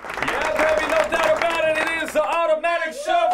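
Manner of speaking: high, fast announcer hype; high-energy radio intro shout; radio host hype. Acted. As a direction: pump up the show like a DJ.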